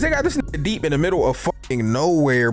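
ordinary talk, nothing marked

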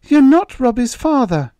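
put-on very high, loud little girl; defiant child's outburst. A narrator's reading. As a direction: stand up to him, shrill and loud. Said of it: "spoke up defiantly then".

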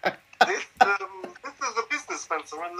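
lightly voiced posh Eubank drawl; a hint of Eubank's posh lisp; Chris Eubank voice. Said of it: "do the, the voice".

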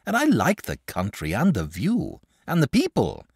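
a sociable dragon telling how he came; easygoing dragon. Acted. Dragon tells the boy his story, lifted voice.

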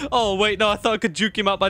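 laughing through the words, high. Real, not acted. Player laughing at his failed dodge, high laughing voice. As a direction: giggle it out at your own mistake.

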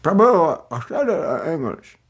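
put-on Scottish accent. Scottish devotee insisting he spoke English, mild Scottish accent. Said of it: "difficult to understand Scottish accent".

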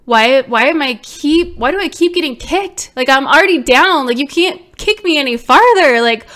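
loud despairing protest; desperate retold outburst. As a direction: cry out against being kicked while down. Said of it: "I'm like".

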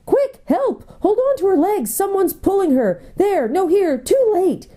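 high, frantic, breathless cries; gasping panic. A narrator's reading. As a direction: gasp it out in a sudden panic. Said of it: "the Marsh Wiggle gasping".

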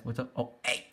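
ordinary delivery

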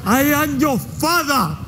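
a hint of a low, slow Vader; Darth Vader voice. Darth Vader quote parody.

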